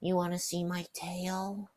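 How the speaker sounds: slow, high creepy offer; mock creepy offer. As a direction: drawl it like a creep.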